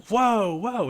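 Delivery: high shocked whoa; startled whoa. Retold or imitated. Crowd reacting as the drunk drops his pants, shocked high whoa. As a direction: pitch way up in shock.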